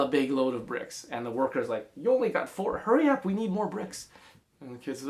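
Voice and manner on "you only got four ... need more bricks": impatient worker. A narrator's reading. Worker hurrying kid.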